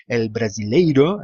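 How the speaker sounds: slow Brazilian Portuguese lilt on the word; Brazilian accent. Shown as a demonstration. Spaniard illustrating how Brazilians keep their accent, Brazilian accent. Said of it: "tienen su, su acento".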